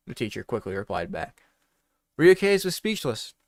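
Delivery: ordinary delivery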